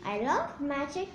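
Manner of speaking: ordinary delivery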